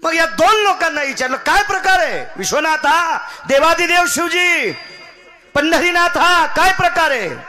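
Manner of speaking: high, very loud cry to the gods; full-force preaching. Acted. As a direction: call out to the gods at the top of your voice. Preacher cries out the question to the gods. (पूर्ण जोशात, उंच आवाजात देवाला हाक)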